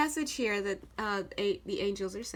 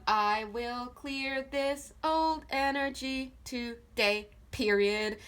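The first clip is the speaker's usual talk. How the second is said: very slow and deliberate, word by word; slow dictation; much slower than usual. Shown as a demonstration. Reader dictating an affirmation to write, slowly spelled out. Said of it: "write this down".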